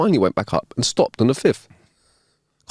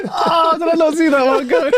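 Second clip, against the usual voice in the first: much higher, through laughter; giggling disbelief; laughing. Real, not acted. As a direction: cackle out the question. Laughing at punchline.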